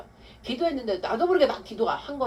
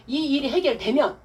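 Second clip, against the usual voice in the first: loud, raised, insistent counsel; emphatic instruction; 6 semitones higher, 7 dB louder than usual. Retold or imitated. Deacon instructs woman how to pray, emphatically. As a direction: press each condition home forcefully.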